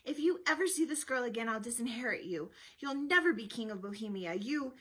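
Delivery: loud, emphatic threats from a furious father; angry threat. A narrator's reading. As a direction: lay down the law in anger. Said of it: "he growled".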